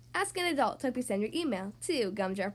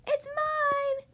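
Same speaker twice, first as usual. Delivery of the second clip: high, drawn-out claiming shout; excited cry; 11 semitones higher than usual. A narrator's reading. Frog chasing a pop-up ball, high excited cry. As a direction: call it high and long, going for the catch. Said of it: "cried Froggy".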